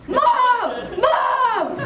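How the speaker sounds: high, loud mock yell for mom; hammed-up cry for help, laughing; mock screaming. [name] retelling calling for her mom, laughing mock scream. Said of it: "So then I just started screaming".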